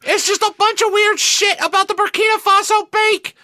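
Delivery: ordinary talk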